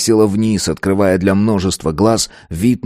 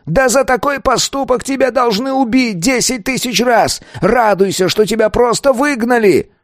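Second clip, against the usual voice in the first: high-pitched, raging elder; furious growling. A narrator's reading. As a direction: rage at the pupil on a strained high pitch. Elder keeps raging at pupil. (гневный рык на высоких нотах)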